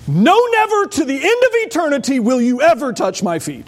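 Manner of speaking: high, loud outburst on never; emphatic refusal. Retold or imitated. Preacher voicing Peter shouting his refusal. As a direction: Peter flatly refusing Jesus. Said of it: "start screaming when you turn to the page".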